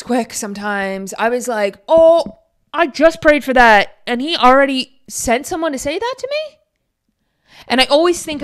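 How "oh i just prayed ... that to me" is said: higher, louder, slowed wonder; amazed disbelief. Retold or imitated. Retelling her answered prayer, amazed and louder. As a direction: marvel that the prayer was answered.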